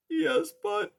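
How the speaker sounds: tiny timid mouse voice, high; timid little mouse. Acted. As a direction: squeak it out like a scared mouse. Poppy speaking.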